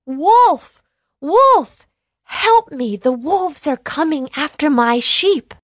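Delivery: high, loud yell of Wolf; yelling for help. Acted. Shepherd boy yelling to the villagers again, loud and high. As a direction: shout it with all your might. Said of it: "He yelled out with all his might".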